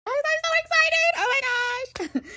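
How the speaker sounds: high-pitched thrilled squeal; gushing with excitement; excited squeal. Real, not acted. Admin thrilled at caseworker joining.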